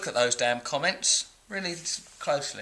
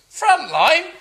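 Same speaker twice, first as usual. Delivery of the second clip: very high, louder disbelieving "Frontline?"; incredulous; 14 semitones higher, 10 dB louder than usual. Real, not acted. Scolding a young commenter, high incredulous call. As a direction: pitch it up in mock disbelief.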